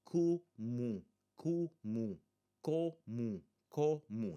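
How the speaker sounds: comum split in two, ending in nasal 'oo'; nasal final U. Shown as a demonstration. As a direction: model 'comum' syllable by syllable, lips open at the end. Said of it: "not gonna press my lips on that one".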